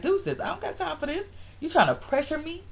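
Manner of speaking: high-pitched brush-off; mock dismissive. Voicing a man fed up with nagging, dismissive. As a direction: imitate a man backing out of pressure.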